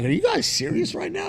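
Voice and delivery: can't believe it, go high; high disbelief. Retold or imitated. Asking the neighbors if they're serious, high disbelief.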